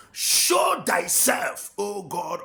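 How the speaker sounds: a slow, loud, high cry of prayer; shouted plea to God. Real, not acted. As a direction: call down heaven with everything. Preacher crying out to God.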